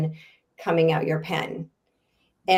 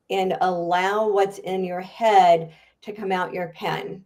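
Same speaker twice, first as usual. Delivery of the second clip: usual speaking voice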